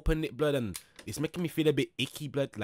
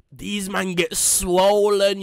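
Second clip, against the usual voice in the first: very slow and louder; drawn-out emphasis; 4 semitones higher, 7 dB louder, much slower than usual. Real, not acted. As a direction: stretch it out for effect. Mocking jail bodies.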